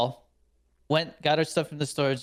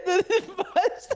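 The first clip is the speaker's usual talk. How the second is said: giggling through the words, high and slow; laughing; slower than usual. Real, not acted. Telling a friend about the blowout, laughing hard. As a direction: laugh helplessly while telling it.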